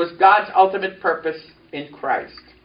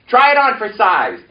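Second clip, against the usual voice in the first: ordinary talk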